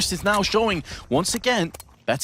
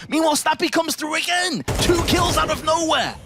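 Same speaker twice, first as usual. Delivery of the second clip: rising, hyped call of the play; excited; 8 semitones higher than usual. Real, not acted. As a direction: lift the voice as the kills land. Casting a big play.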